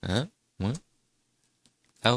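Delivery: ordinary delivery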